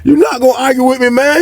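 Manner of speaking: high-pitched, amused comeback; amused retort. Retold or imitated. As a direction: toss it back in a high voice, imitating an argument. Retold argument, amused dealer.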